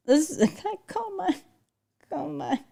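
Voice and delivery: high, soft words lost in laughing; helpless laughter. Real, not acted. Streamer still stuck on the snowflake nickname, too amused to finish. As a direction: try to repeat it but keep giggling.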